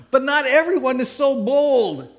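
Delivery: laughing while reading the slide. Real, not acted. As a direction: push the words out through a laugh. Still laughing after mix-up.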